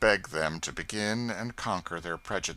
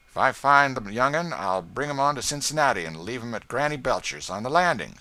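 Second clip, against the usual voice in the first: louder rough promise; gruff catcher; 5 semitones higher, 8 dB louder than usual. Acted. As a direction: state it bluntly. Locker promising.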